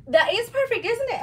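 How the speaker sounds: high, loud delight; excited. Real, not acted. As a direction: gush about it. Delighted with matching webbing.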